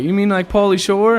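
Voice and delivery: mock asking. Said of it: "I get it from everybody".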